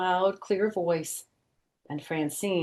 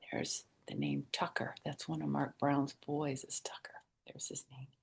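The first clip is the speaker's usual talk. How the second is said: whisper; 4 semitones lower, 13 dB quieter than usual. Real, not acted. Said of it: "whispered aside".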